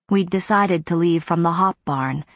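ordinary delivery

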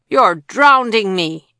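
put-on loud, slow child's wail. A narrator's reading. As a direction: yell it as if being drowned. Matt protesting in bath.

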